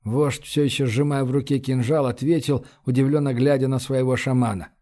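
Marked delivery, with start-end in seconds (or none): none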